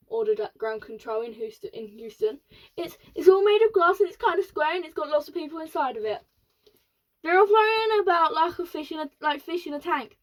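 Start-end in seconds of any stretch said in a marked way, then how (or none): 2.8-10.2 fast, higher and louder, breathless wonder; excited astronaut. Acted. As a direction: rattle it off in astonishment. Astronaut describing strange ship.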